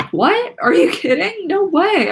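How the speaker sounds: high, loud, stunned outbursts; can't believe the good news; sputtering disbelief. A narrator's reading. Nikki hearing the producer loved their song, sputtering. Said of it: "I sputtered".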